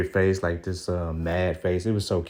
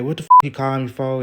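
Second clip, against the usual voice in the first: mock angry snap, quicker, higher and louder; retold irritated demand, raised and fast; imitating her anger; 6 semitones higher, 7 dB louder, faster than usual. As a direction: act out her sudden flip to mad. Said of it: "she just instantly got mad".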